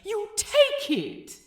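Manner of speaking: a hysterical squeal of self-reproach; frantic inner outburst. A narrator's reading. Aziraphale scolds himself, frantic.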